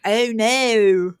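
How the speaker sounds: a hint of a drawn-out Delco "Oh, no"; Delco accent. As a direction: lightly do the Delco "Oh, no". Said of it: "That's the Delco accent".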